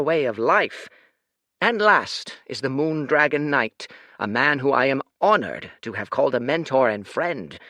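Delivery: a proud ruler proclaiming to a crowd; lordly speech. Acted. Lord's grand public speech.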